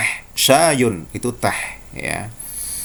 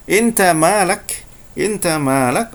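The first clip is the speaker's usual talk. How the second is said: a mild Egyptian Arabic challenge, a touch higher; Egyptian Arabic accent; 6 semitones higher, slower than usual. Shown as a demonstration. Teacher showing the Egyptian way to ask what you want. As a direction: toss it off like a Cairo local. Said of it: "orang Mesir akan mengatakan".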